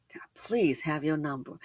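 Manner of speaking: whispered retelling of his request; whispered line. Retold or imitated. Storyteller whispers the man's plea for her number. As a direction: whisper it like a sly pickup line.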